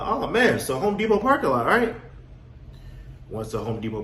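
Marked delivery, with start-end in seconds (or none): 0.0-2.0 high, eager lightbulb moment; mock amazed, voice jumping up; excited realization. To his wife about the parking lot, eager and high.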